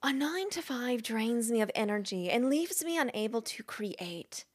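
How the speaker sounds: earnest in-character recital, stretched out; Julia Cameron. Acted. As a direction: read with weighty pauses, slipping into whisper. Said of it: "read it like I think it's Julia Cameron".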